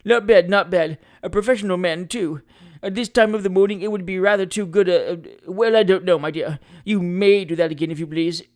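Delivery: hesitate, then graciously give in; self-satisfied lawyer breaking off mid-phrase; pompous attorney, pleased. Acted. Attorney accepts another drink, amused.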